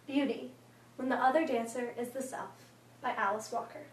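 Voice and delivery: quiet, measured announcer-like introduction; formal introduction. A narrator's reading. Speaker introducing a story by Alice Walker, composed and formal.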